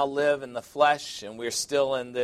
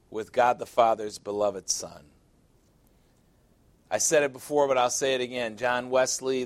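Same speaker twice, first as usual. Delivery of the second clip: normal talking voice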